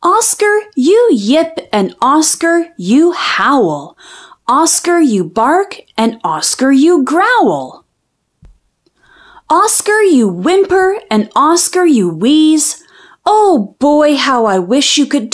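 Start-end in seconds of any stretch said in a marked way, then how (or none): none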